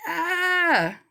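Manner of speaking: sudden high yelp of dismay; a sharp surprised cry; startled yelp. Retold or imitated. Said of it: "and I'm like".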